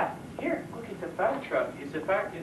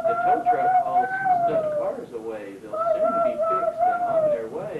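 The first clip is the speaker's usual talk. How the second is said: very high, loud storybook voice; high sing-song reading; 7 dB louder than usual. Acted. Reading truck book, sing-song.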